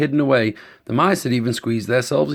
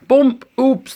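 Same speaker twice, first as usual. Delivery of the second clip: playful exclamation; 11 semitones higher, 4 dB louder than usual. A narrator's reading. Story sound effect read aloud.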